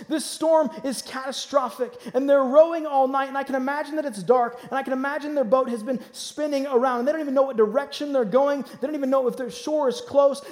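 loud, high preaching build; preaching at full force. Acted. Preacher stirring the congregation, loud and rising. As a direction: preach the storm to the crowd.

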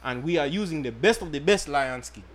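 mock African accent. Podcast host selling condoms in a light mock African accent. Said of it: "I must give you my best African accent".

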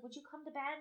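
hushed retold bedtime question; quiet, re-enacted night-time request; soft coaxing. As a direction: gently coax a sleepy partner, very softly. Coaxing sleepy partner, retold.

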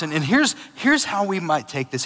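ordinary delivery

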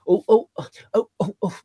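straining to see the ring; mock excited oohs; mock craning excitement. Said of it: "you have to kinda go".

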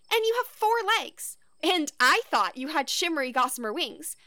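building to high, loud outrage; outraged. Acted. Kid outraged at imposter.

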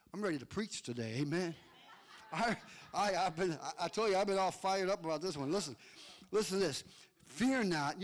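ordinary delivery

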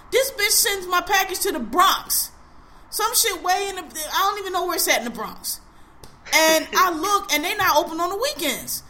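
can't believe they sent it to the Bronx; indignant, half-laughing rant; outraged disbelief. Real, not acted. Outraged retelling of misdelivery.

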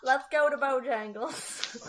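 slow mock Southern accent; bad Southern accent. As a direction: draw the words out in a playful Southern accent. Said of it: "Give me your worst Southern accent".

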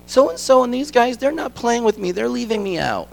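put-on high child's voice complaining, sinking at the end; hurt little girl. Daughter telling dad she's left out, high and hurt. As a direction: sound like a left-out young girl.

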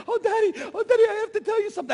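high, loud, rushed gushing; giddy daughter. Retold or imitated. Father imitating daughter with news for Daddy, giddy. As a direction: mimic an over-excited girl bursting with news. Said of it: "she's all giddy".

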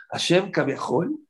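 ordinary delivery

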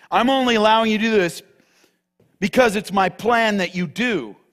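fiery pulpit delivery, Jesus' words proclaimed; full-force preaching. Acted. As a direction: preach it hard to the church. Preaching the arrest scene.

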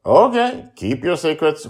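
high, loud playful dad; high mock-resigned fatherly voice; teasing father. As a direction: let it go with a grin. Father Justin teases.

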